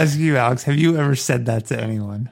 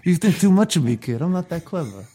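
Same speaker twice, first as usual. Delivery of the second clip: lightly voiced movie crooner, pitched up; half-hearted impression, higher than usual; Johnny Fontane; 5 semitones higher than usual. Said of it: "What does Johnny sound like?".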